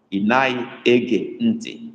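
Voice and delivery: Inai Ege Nti spelled out very slowly; slow syllable by syllable. Shown as a demonstration. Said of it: "it's I with dot under".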